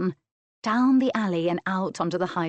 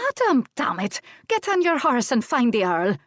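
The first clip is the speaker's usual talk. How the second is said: high, loud Scots scolding; exasperated order; 6 semitones higher, 5 dB louder than usual. A narrator's reading. Wife turns on her husband to send him off, exasperated.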